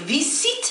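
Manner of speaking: French "visite" wrongly tried with S instead of Z; questioned S pronunciation. Shown as a demonstration. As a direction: say "في سيت" as "vissit", with S for the middle sound. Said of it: "يا هل ترى ننطقه".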